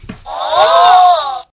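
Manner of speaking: high, loud surprised gasp. A narrator's reading. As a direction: gasp at something strange. Peppa notices something strange.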